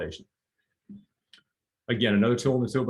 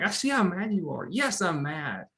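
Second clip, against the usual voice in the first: higher, empathetic reflecting voice; validating parent; 9 semitones higher than usual. Shown as a demonstration. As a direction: say it back to the child as if feeling it too. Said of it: "you reflect back to them".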